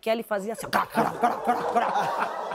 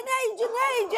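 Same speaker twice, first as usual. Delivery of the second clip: high pleading cry of a name; high desperate calling out; desperate plea; 11 semitones higher than usual. Retold or imitated. Crying out to Neide in pain.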